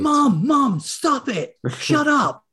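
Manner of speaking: high-pitched pleading son, imitated; mock screaming. Son shutting up his bothering mom, mock exasperated cry. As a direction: act a grown son squirming at his mother. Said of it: "he'd be screaming".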